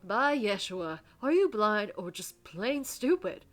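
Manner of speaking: loud, exasperated scolding; angry outburst. Acted. Reverend scolding Jory for defying him, angry. Reverend furious at Jory's refusal.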